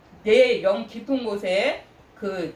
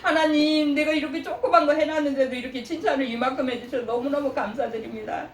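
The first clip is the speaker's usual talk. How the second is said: grateful thanks; 7 semitones higher than usual. Retold or imitated. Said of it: "막 감사하고 감격하면서".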